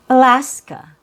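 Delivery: Alaska with schwa start and end, stressed middle; model word Alaska. Shown as a demonstration. Teacher introducing the word Alaska. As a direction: say Alaska as uh-LAS-kuh. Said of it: "So we have Alaska".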